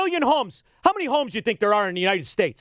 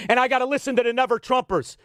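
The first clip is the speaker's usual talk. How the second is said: ordinary talk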